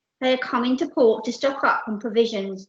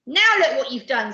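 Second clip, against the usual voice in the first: loud, reproachful telling-off; scolding; 4 semitones higher, 7 dB louder than usual. Acted. Percy blaming the captain, reproachful scolding. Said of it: "scolded Percy".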